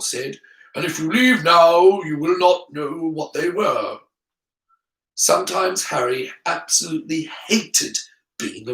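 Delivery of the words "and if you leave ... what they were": put-on measured old wizard voice; solemn Dumbledore. Dumbledore trying to keep Harry from leaving, solemn. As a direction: speak as a regretful headmaster, put-on voice.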